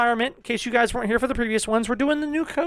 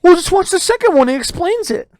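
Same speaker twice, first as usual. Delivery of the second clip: mock fan advice; 7 semitones higher, 8 dB louder than usual. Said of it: "how many times I've heard this".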